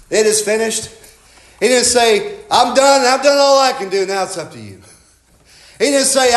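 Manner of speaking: high, loud, rolling preacher's cadence; full-force preaching. Acted. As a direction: preach it out to the congregation.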